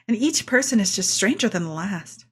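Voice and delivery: ordinary delivery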